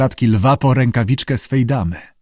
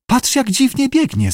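coolly critical inner voice, high at first; cold critical voice; 12 semitones higher than usual. A narrator's reading. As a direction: judge her run, detached and cold. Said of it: "chłodny i krytyczny głos". (chłodny, krytyczny głos)

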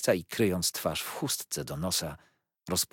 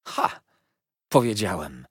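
triumphant cry; 6 dB louder than usual. A narrator's reading. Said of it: "wołał pan Micawber".

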